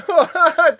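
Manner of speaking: high loud burst of laughter. Real, not acted. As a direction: let out a big laugh. Laughs loudly.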